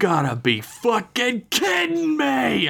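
high, drawn-out exasperated scream; outraged high-pitched outburst. A narrator's reading. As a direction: scream it in disbelief, stretching the words. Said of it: "I screamed out".

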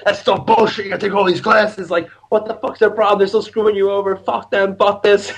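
high-pitched imitation of a ranting dad; mock-angry father, swearing freely; outraged dad. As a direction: keep ranting indignantly, pitched well up. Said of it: "just polar opposite".

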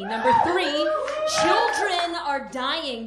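loud, high shout breaking into a laugh; yelling. Acted. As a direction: yell it with a laugh. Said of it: "you can just yell at them".

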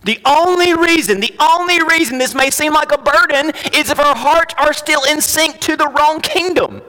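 high, insistent; impassioned preaching. Acted. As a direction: urge them with rising pitch. Raised preaching.

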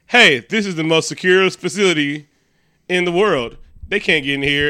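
hammed-up bragging, punched up loud; cocky boasting. Movie character boasting his place is safe, cocky.

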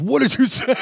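high-pitched imitation of an affronted man; mock outrage. Ballplayer stands up to confront his heckler, high mock outrage. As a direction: act indignant with a laugh breaking in. Canseco stands up in retold quarrel.

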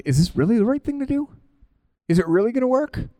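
high, quick doubting questions; acted uncertainty. Believers questioning whether the risk is right, acted uncertain. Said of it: "we go through the uncertainty".

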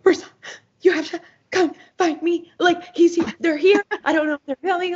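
high, squeaky retold panic; frantic excitement. Fan phoning a friend about a sighting, frantic excitement. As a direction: gush in a very high, urgent near-wail.

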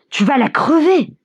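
high-pitched protest from a bystander; shouting. A narrator's reading. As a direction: cry out in protest, voice lifted. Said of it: "crie un troisième". (cri de protestation, voix haute)

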